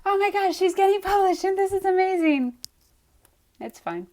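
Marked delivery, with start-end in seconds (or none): 0.0-2.5 high-pitched squeal of pretend excitement; mock joy. Streamer voicing the reaction viewers expected, mock overjoyed. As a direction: gush like a thrilled fan, pitched way up. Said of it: "You're expecting to be like".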